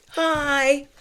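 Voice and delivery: high, drawn-out little hi; meek greeting. Retold or imitated. Greeting the crowd of medical staff.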